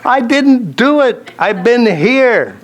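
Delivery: high, drawn-out plea of innocence; hammed-up wounded denial; mock indignant protest. Wrongly blamed husband protests innocence.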